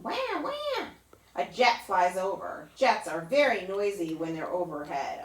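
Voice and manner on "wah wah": high mock baby wail; baby cry imitation. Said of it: "A baby crying".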